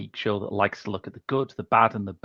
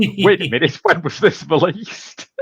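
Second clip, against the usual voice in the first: high and loud, mock alarm; a sudden jolt of realisation; mock surprise; 9 semitones higher, 8 dB louder than usual. Imagined listener realising age.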